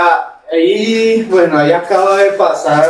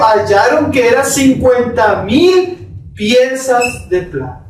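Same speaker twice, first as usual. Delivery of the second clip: lee el pasaje despacio, marcando cada frase; lectura bíblica solemne; 6 semitones higher, slower than usual. Acted. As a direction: proclama la Escritura con peso. Lee la Escritura en el sermón. (lectura solemne del pasaje)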